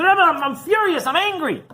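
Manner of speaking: high, loud outburst of anger; acted furious yelling. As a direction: play the enraged man yelling his complaint. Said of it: "he was screaming".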